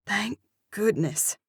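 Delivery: ordinary delivery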